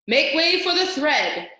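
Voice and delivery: loud, slow announcing call; cried out. A narrator's reading. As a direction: proclaim it over the crowd. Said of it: "cried a mouse".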